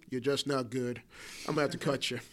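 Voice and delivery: low, quiet mock coach verdict; understated, softly imitated coach dismissal; retold letdown. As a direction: deliver the bad news low and soft. Retelling coach cutting him.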